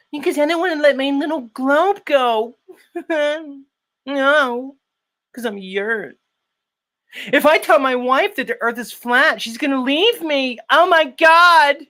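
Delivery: mock Yurt voice. Mocking a rival's fear his wife leaves. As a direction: parody the rival's panicky whining.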